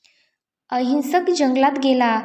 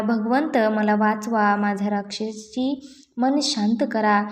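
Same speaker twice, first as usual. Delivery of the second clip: ordinary delivery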